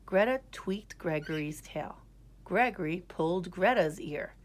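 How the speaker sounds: usual speaking voice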